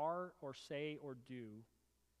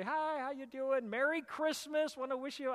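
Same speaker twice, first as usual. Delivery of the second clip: bright, higher, louder cheery greeting; friendly hello; 8 semitones higher, 5 dB louder than usual. Retold or imitated. Walking into a bar, greeting the regulars with a cheery hello. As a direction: breeze in greeting everyone merrily.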